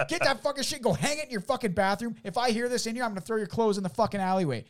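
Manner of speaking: high-pitched furious tirade; retold worked-up ultimatum; angry threat. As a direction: act out ordering a neighbour out.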